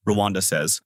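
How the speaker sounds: ordinary delivery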